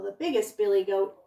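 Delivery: ordinary delivery, nothing marked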